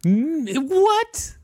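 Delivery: what leaping high in disbelief; squeaky stunned what; incredulous what. Real, not acted. Cohost reacting to the forty percent figure, incredulous.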